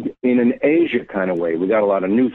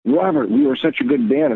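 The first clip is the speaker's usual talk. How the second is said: thrilled, much higher voice, imitating his friend; excited Keith; 12 semitones higher than usual. Keith phoning Robert, thrilled about hearing the old CD: excited high voice. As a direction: replay his giddy phone call, pitched way up. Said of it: "he called me so excited".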